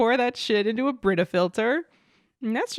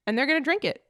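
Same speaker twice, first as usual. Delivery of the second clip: ordinary talk, nothing marked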